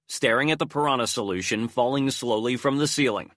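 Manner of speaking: plain reading voice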